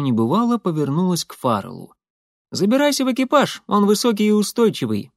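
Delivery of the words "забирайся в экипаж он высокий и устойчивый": high, brisk order from Molly; commanding Molly. A narrator's reading. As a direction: give quick instructions in a raised voice. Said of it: "приказала она".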